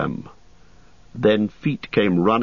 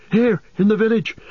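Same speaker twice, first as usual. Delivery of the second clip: breathless fear; 3 dB louder than usual. Acted. Merry still breathless.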